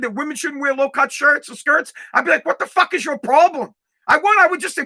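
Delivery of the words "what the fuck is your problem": indignant challenge, pitched high; mock confrontation. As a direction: challenge him like a debate opponent. Acted confrontation in imagined debate.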